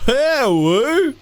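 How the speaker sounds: high, loud squeaky hello; mock tts hello. Streamer parroting the text-to-speech greeting in a high squeak. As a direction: squeak the hello back like the robot voice.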